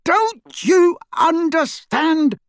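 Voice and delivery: high, slow shouted plea. A narrator's reading. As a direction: shout it in frustration. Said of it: "shouted Harry".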